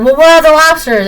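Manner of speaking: a boss demanding the missing order. Retold or imitated. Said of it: "your boss is like".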